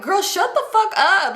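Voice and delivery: high, drawn-out exasperation; exasperated retort. Retold or imitated. Aunt silencing the tattling child, acted exasperation. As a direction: stretch it out, pitch way up, pleading for silence.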